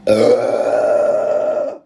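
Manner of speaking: deep groan after a fizzy gulp; low strained groan. Real, not acted. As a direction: groan low from the soda burn. After a big gulp of soda.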